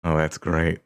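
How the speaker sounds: ordinary talk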